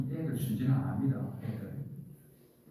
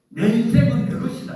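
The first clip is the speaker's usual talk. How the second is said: high, loud, arrogant declaration; brash, loud self-assertion; defiant boast; 9 semitones higher, 11 dB louder than usual. Retold or imitated. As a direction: shout it like someone who needs no God. Voicing a proud person.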